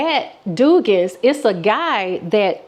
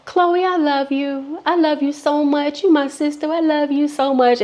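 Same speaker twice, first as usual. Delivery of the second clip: put-on high drunken gush; imitating the tipsy friend's sloppy affection; 5 semitones higher than usual. Drunk friend at Dugan's declaring love, high gushing. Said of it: "she drunk now".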